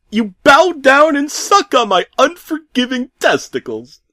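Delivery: high, loud grotesque order; barking command. Acted. Pizza man ordering the narrator, high and loud. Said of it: "he barked back".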